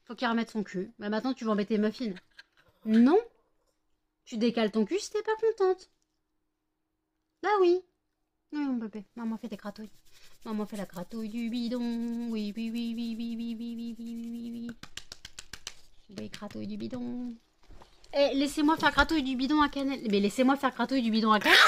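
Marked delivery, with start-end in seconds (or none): 4.2-7.8 high, loud scolding; scolding yell. Real, not acted. Scolding the dog. 8.5-17.3 soft cooing to the dog. Real, not acted. 18.1-21.6 louder, higher telling the dogs off; frustrated owner calling to pushy dogs. Real, not acted. Shooing the other dogs.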